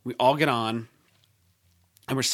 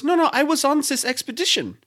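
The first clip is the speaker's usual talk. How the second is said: high-pitched imitation of the Icelandic guide; mock Icelandic accent, pitched right up; Icelandic guide's accent; 12 semitones higher than usual. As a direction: modestly correct them in an Icelandic lilt. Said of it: "Or he goes".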